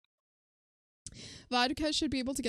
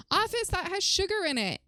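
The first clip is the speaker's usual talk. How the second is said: high-pitched, playful telling-off; scandalized scolding; 8 semitones higher than usual. Real, not acted. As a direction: scold a friend, pitch jumping up. Mock scolding of a chat member.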